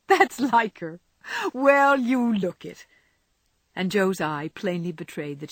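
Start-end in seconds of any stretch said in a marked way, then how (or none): 0.1-2.9 higher, louder, emphatic delight; hearty exclamation. Acted. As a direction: exclaim it, stretching the second remark. Jo exclaims over Laurie's title.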